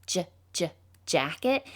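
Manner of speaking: teacher sounding out jacket. Shown as a demonstration. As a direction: isolate the j sound twice before jacket. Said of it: "if I were to say the word jacket".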